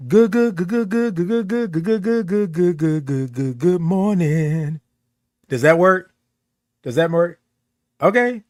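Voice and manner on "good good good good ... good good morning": rapid chanted run of goods sliding down; stuttered greeting. Shown as a demonstration. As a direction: repeat good like a sputtering motor, then morning. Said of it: "Or you can say".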